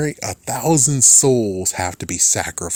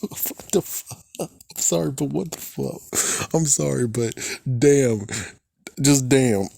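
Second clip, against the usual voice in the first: laughing through words; 4 semitones higher than usual. Real, not acted. Cracking up at the show.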